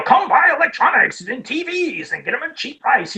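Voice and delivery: high, hammed-up local commercial pitch; crazy ad voice. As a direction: do a crazy salesman luring shoppers downtown. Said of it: "that whole crazy voice somebody does".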